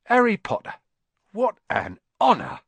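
put-on high, loud, thrilled old barman; awed exclamation. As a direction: exclaim it like meeting a hero. Awed barman greets famous Harry.

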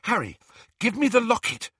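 high, urgent demand; impatient. A narrator's reading. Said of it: "she said impatiently".

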